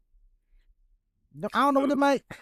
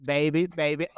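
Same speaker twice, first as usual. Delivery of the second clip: flat reading of lyrics; 7 semitones lower than usual. Pretend. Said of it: "Why you reading it like that?".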